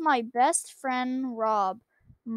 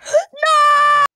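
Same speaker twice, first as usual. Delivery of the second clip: horrified shout; 14 semitones higher, 12 dB louder than usual. Real, not acted.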